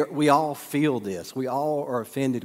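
normal talking voice

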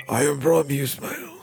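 terrible impression of a colleague; badly imitated colleague introducing himself; Rami Ismail. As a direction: do a bad impersonation of your co-host. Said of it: "That was a terrible impression".

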